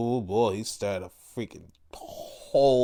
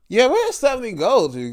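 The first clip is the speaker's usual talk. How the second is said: puzzled; 11 semitones higher, 5 dB louder, faster than usual. Real, not acted. Puzzled about vanishing.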